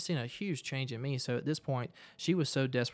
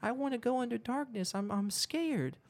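put-on whiny three-year-old; 10 semitones higher than usual. Friend acting like a toddler, mock scared and childish. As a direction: imitate a toddler begging for a blankie. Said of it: "like a little three-year-old".

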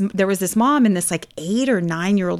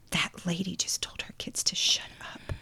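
hushed little tattletale; retold scandalized whisper; imitated tattling whisper; 13 dB quieter than usual. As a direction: whisper it like a kid telling on someone. Child tattling to her mom, retold.

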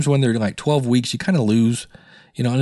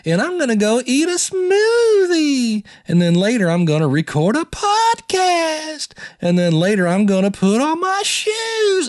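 much higher and slow, every phrase ending on a long flat held note; hammed-up sing-song listing of plans, each line drawn out high; imitating Ruby's speech pattern; 11 semitones higher, slower than usual. Podcaster mocking Ruby's habit of drawling out every plan, high and slow. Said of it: "she has her own speech pattern".

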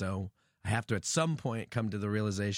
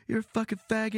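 high jeering heckler shout; 12 semitones higher, 4 dB louder, faster than usual. Retold or imitated. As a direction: yell it like a heckler in the crowd. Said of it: "an impression of a heckler".

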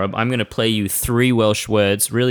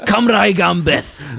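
high, drawn-out Welsh slogan; rousing Welsh battle cry; patriotic cry; 8 semitones higher, 3 dB louder, slower than usual. Pretend. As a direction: call it out slowly like a proud chant.

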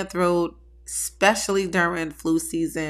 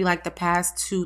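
ordinary talk

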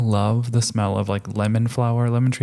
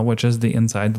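ordinary talk, nothing marked

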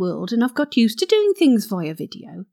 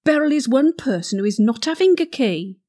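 usual speaking voice

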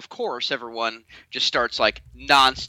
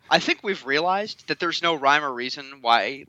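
ordinary talk, nothing marked

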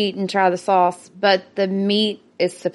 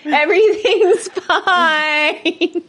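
laughing; 7 semitones higher, much slower than usual. Real, not acted. Mouth on fire, laughing.

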